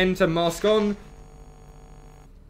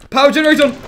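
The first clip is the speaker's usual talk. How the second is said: higher, louder rushed commands; panicked callouts; 9 semitones higher, 10 dB louder, faster than usual. Real, not acted. Player racing through controls under attack, panicked callouts. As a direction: bark orders in a panic.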